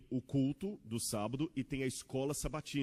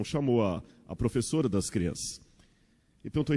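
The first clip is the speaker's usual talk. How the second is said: ordinary talk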